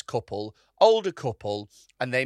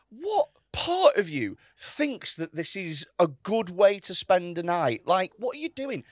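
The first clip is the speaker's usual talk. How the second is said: higher, slow, drawn-out disbelief; incredulous scolding; 4 semitones higher, slower than usual. Retold or imitated. Comedian scolding heckler at charity night, incredulous. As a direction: spell out the outrage phrase by phrase.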